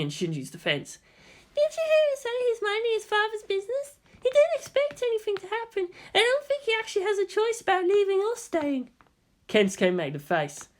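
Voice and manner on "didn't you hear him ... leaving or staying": Hikari, gentle. A narrator's reading. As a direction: calmly stand up for the new boy. Hikari defends Shinji.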